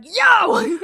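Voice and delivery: high shouted "Yo!"; shouting. Retold or imitated. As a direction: call out loud and high. Imitating Scrub shouting.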